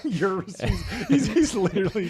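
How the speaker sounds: high laughing voice. Real, not acted. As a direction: talk through laughter. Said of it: "laughing at joke".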